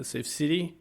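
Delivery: normal talking voice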